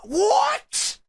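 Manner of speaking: shocked what. Retold or imitated. Son's shocked reaction.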